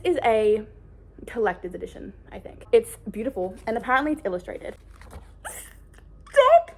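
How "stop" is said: high loud delighted squeal; excited shriek. Real, not acted. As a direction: squeal with joy at the book. Overwhelmed by beautiful edition.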